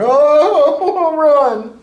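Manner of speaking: very high, loud laughing oh run; laughing warning. Retold or imitated. Watching his wife about to snap at the fan, laughing warning. Warning man before she snaps.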